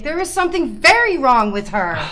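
"very" hit hard and loud, falling at the end; stress on very. Acted. Mother pleading with the doctor, loud stress on "very". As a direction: lean on "very" in anger. Mother insists daughter is sick.

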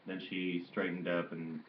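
usual speaking voice

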